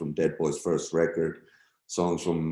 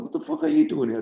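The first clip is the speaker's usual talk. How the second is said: amazed shout; faster than usual. Retold or imitated. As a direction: shout in happy disbelief. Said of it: "I was like".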